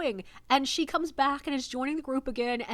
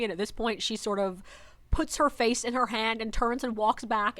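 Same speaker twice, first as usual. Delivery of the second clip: ordinary talk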